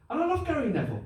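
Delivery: usual speaking voice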